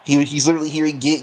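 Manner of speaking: ordinary talk, nothing marked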